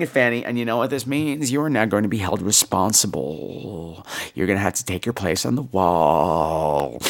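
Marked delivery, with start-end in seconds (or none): 1.5-7.0 low, slow mock-sinister warning; low slow mock villain voice; mock ominous threat. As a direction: menace her playfully, low and slow.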